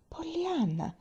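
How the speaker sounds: shocked outcry. A narrator's reading. Aunt hears Pollyanna is glad of the accident: shocked high cry. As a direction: gasp the name out in dismay, pitched high.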